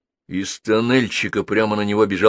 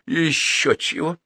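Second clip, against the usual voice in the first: contemptuous snort; 6 semitones higher than usual. Acted. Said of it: "презрительно фыркнула Долис".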